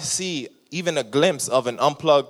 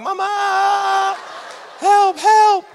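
put-on much higher, frantic little-kid cry for help; panicked kid calling; 6 dB louder, much slower than usual. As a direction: play the scared kid at the door. Kid banging on grandma's door.

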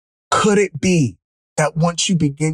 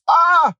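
very high, open cry of "ah"; high exclaimed "ah"; 13 semitones higher, 4 dB louder than usual. Real, not acted. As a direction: let out a sudden high "ah". Overcome exclamation mid-testimony.

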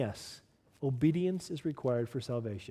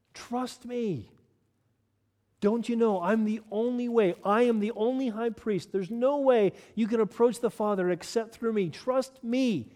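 higher pitched, pleading and insistent; urgent command; 6 semitones higher than usual. Acted. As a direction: press it on them, rising. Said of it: "he commands us".

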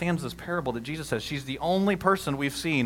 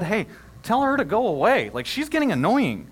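annoyed disciples; 8 semitones higher, 6 dB louder than usual. Retold or imitated. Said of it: "Eventually, the disciples said".

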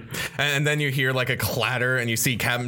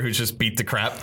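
normal talking voice

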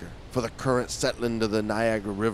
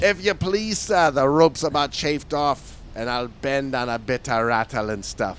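faux Scottish accent, starting high and loud; attempted Scottish accent; 4 semitones higher, 5 dB louder than usual. Retrying the sailor's request to the captain, attempted Scottish accent. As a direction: take another run at a Scottish sailor.

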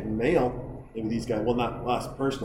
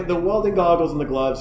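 ordinary delivery

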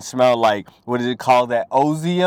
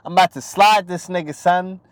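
New York talk; 8 semitones higher, 6 dB louder than usual. Retold or imitated. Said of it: "they're like".

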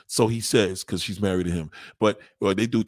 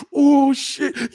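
mock pleasure; 12 semitones higher, 7 dB louder than usual. Man squirming with pleasure.

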